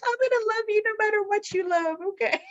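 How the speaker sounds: high, sing-song mom reassurance; sweet mom voice. Retold or imitated. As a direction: coo it high like a doting mom. Mom telling kids, acted quote.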